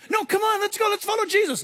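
high, insistent rallying call; acted high-pitched enthusiasm; eager urging. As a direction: coax reluctant friends along. Acted believer urging others to follow.

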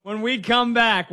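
laughing through the number; breaking up with laughter. Real, not acted.

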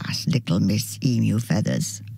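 plain reading voice

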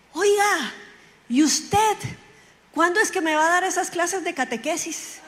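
high-pitched mock yell from a car window; calling out. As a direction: call across the street, imitating a friend. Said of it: "me ve y baja el vidrio y me grita".

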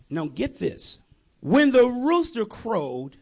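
ordinary talk, nothing marked

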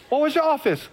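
mock-confused high question; puzzled questioner. Voices puzzled people's reply.

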